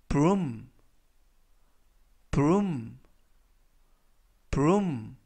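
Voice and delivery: broom said slowly three times, oo as a long u; broom pronunciation. Shown as a demonstration. Teacher modelling broom for students, slow and clear. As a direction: model the word broom for learners. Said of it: "이라고 발음하시면 되겠고요".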